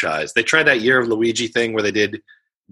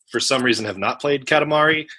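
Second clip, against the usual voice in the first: ordinary talk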